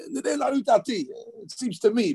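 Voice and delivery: "Nir'eh la'ani da'ati" pitched high with a tentative lilt; high modest hedging tune; humble intonation. Shown as a demonstration. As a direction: lift "Nir'eh" high and let it fall modestly. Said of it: "You have to know the intonation".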